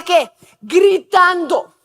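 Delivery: ordinary talk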